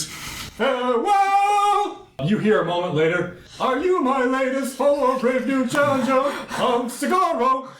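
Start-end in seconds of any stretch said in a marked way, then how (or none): 0.0-2.1 very high, drawn-out call; grand warrior farewell. Acted. 3.6-7.7 high, slow, grand warrior voice; booming warrior challenge. Acted. Warrior voice from distance.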